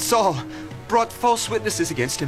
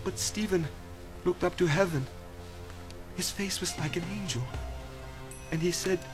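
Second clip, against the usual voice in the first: soft, low, reverent telling; hushed grief; 3 semitones lower, 11 dB quieter than usual. Acted. Messenger recounting Stephen's death to friends, hushed grief. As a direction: let it fall quiet, with long pauses.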